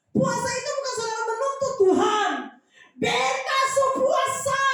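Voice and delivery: fervent, forceful exhortation, pitched high; impassioned preaching. Real, not acted. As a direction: preach at full force, climbing to a long cry. Preaches at full force, building.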